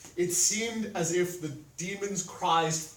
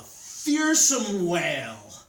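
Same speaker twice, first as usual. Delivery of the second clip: loud, drawn-out emphasis; slow, booming narration; dramatic storytelling; 7 dB louder, much slower than usual. A narrator's reading. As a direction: stretch the words out like the wail. Narrator dramatizes the demon's wail.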